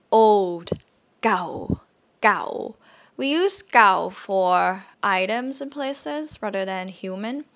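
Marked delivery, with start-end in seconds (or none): 1.2-2.8 gao on a low, level tone. Shown as a demonstration. Teacher modelling low-tone gao, old. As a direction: keep gao low and flat. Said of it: "Low tone".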